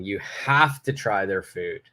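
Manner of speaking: "have to" stretched out for a keen recommendation; emphasis on HAVE TO. Shown as a demonstration. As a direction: lean hard and long on "have to". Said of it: "I emphasize the word have to".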